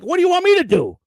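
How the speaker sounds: high, loud exasperated outburst; exasperated yell. Retold or imitated. Son to his crying father, high exasperated yell.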